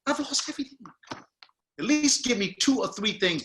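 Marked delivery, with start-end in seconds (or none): 0.1-0.8 rising pitch, climbing higher line by line, no pauses; lines run together. Shown as a demonstration. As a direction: drive it through in one breath, climbing. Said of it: "say in couplets and triplets".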